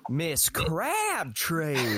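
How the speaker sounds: slow, high, drawn-out Southern drawl. Retold or imitated. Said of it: "they all had different accents".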